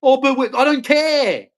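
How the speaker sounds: high, whiny imitation; mocking opponent. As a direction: throw their reply back in a mock high voice. Mimics the other side's reply.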